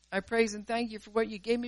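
normal talking voice

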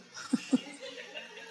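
a high, breathy giggle; little embarrassed laugh. Real, not acted. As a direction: giggle at your own excuse. Laughing at own excuse.

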